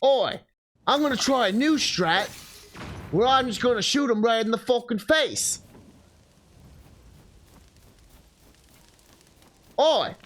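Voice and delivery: hammy Aussie croc-hunter energy; Australian wildlife presenter. Pretend. Aussie wildlife-presenter bit with moblins.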